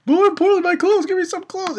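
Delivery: put-on high, girlish plea for clothes; girly Lucy voice. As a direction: pitch way up like a flustered anime girl. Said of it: "and she's like".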